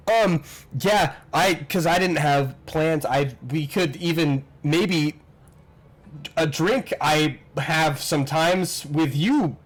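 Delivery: halting, stammered shy invitation; awkward stop-and-start, losing the thread; fumbling his words. Acted. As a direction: stumble nervously through asking someone out. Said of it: "fumble fucking his words".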